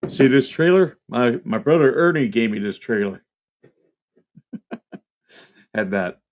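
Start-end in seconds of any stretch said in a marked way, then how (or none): 0.1-3.2 put-on Rust Belt accent. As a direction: lightly do a Rust Belt old-timer. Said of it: "he used to talk like this".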